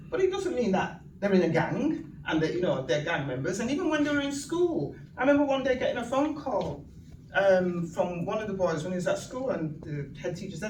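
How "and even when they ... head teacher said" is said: normal talking voice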